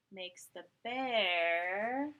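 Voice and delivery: ordinary delivery, nothing marked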